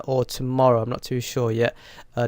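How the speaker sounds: ordinary talk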